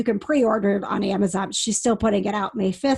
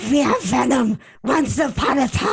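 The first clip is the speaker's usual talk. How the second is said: mock Venom voice; 3 semitones higher than usual. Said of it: "His Venom voice, where he's like".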